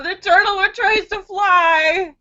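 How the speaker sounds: high, slow words through tears of laughter; laugh-crying. Real, not acted. Laughing at her own turtle joke, high and teary. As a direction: squeak it out while laughing hard.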